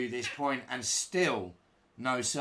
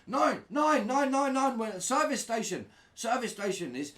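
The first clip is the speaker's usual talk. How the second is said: much higher, loud panicked babble; 12 semitones higher, 5 dB louder than usual. Retold or imitated. Terrified hitchhiker blurting at the driver, high loud panic. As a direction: blurt it out in a frightened rush, pitched way up. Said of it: "I start to panic and I went".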